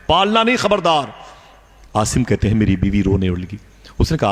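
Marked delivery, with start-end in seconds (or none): 0.1-1.1 high sharp warning on नहीं; stern warning. Retold or imitated. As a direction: threaten her, ending high. Husband warns pregnant wife. (बीवी को धमकाता शौहर, ऊँची कड़क चेतावनी)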